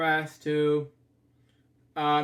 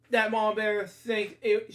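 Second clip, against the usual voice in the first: ordinary delivery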